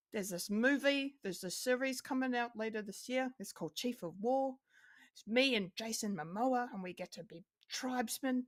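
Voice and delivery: a playful, self-admittedly bad New Zealand accent impression; Tamira Morrison impression. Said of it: "apologies for my terrible New Zealand accent".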